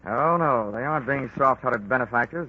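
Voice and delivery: ordinary talk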